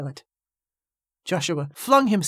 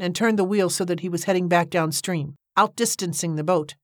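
plain reading voice

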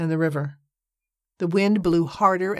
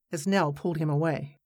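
ordinary talk

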